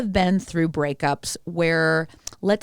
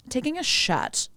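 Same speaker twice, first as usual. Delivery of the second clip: higher, proper British "shot"; British accent; 6 semitones higher, 3 dB quieter than usual. Shown as a demonstration. Podcaster showing friend how the British say it, British accent. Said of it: "and how they say".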